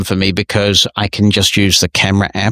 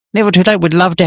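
cheery insistence; 10 semitones higher, faster than usual. Real, not acted. Host repeating his invitation to the pastor, high and bright. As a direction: repeat it with a warm upward lift.

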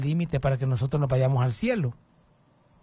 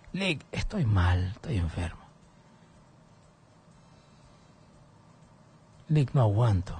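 enfermo; 7 semitones lower than usual. Retold or imitated. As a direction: drag it out weakly, as if too ill to talk. Retells telling caller he is ill. (voz baja de enfermo, sin fuerzas)

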